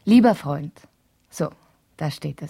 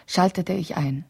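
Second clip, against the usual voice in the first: ordinary delivery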